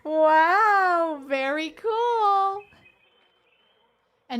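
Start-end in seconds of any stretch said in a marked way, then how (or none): 0.1-2.6 loud, high, drawn-out sarcastic wow; mock delight, stretched out slowly; loudly sarcastic at the jump scare. Real, not acted.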